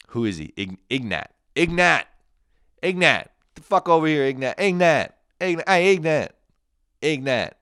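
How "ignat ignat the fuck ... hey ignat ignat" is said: loud, high shout across the room; calling out. Pretend. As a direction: yell his name to get his attention. Calling out to Ignat.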